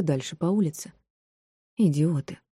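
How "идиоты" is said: understated annoyed remark; grumbled insult. A narrator's reading. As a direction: grumble it to yourself. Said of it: "пробурчала София".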